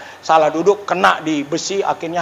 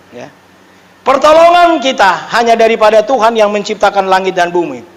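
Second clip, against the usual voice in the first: ordinary talk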